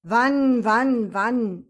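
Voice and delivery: loud, insistent repeated "Wann". A narrator's reading. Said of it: "Emil hob seine Stimme".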